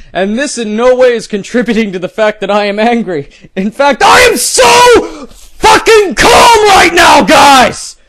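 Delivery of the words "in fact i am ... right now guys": much higher, louder, slow furious yell; mock furious shout. Mocking the poster who claims calm, yelling. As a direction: scream that you're calm.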